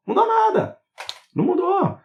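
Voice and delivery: high squeaky scoff; mocking squeak. Scoffing at viewers whose healing failed, high squeak. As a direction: squeak the denial to mock them.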